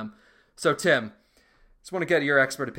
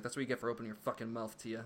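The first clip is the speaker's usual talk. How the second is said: much softer, fast spiteful murmur; mock menacing hush; 2 semitones lower, 13 dB quieter, faster than usual. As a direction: sneer it under your breath. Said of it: "He's like".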